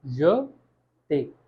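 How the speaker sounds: "य", pause, then "ते"; syllable split. Shown as a demonstration. Teacher showing the two syllables to learners, split apart. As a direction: slowly separate "य" from "ते" with a clear gap. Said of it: "जब दो हिस्से में break हो रहा है".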